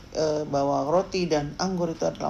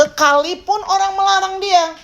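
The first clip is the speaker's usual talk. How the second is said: high, raised preaching voice; emphatic preaching; 11 semitones higher, 4 dB louder than usual. Real, not acted. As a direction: push the line up high, pressing. Preaching climax about shouting louder. (khotbah lantang bernada tinggi)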